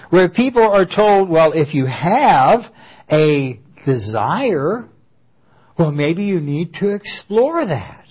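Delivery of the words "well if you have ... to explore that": mock gentle counsel. Preacher mocks counselors urging people to explore desire, slow. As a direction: drawl the advice out like a syrupy counselor.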